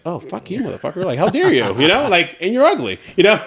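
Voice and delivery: high, hushed mock cursing; mock furious retort. Husband retelling his inner reply to the trolls with mock fury. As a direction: spit it back at the trolls, half joking. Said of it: "all I kept thinking was like".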